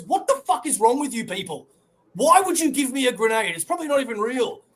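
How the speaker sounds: high, loud disbelieving rant; exasperated outburst. Retold or imitated. Heroine yelling at the grenade givers, high and furious. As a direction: scold them like they've lost their minds. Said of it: "And she goes".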